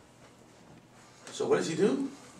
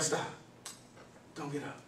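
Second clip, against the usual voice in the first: a hushed, urgent command to stay put; low hushed warning to the wife; hushed stop; 4 semitones lower, 17 dB quieter than usual. Retold or imitated. Father hushing his wife so she leaves the crying boy, hushed. Telling wife not to help.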